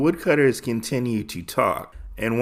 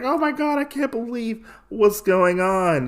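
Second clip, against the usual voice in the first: mock amazement; 10 semitones higher than usual. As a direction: gush in imitated astonishment. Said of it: "they're so amazed".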